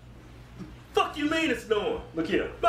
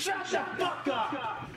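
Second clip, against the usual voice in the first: shouting; 4 semitones higher, 5 dB quieter, much slower than usual. Real, not acted. Shouted retort.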